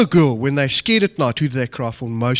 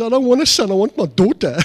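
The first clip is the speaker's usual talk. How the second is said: lightly voiced higher mother-in-law; a hint of a tearful mother, pitched up; mother-in-law protesting; 7 semitones higher, 4 dB louder than usual. Mother-in-law refusing a son-in-law, lightly mock-tearful. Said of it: "She says".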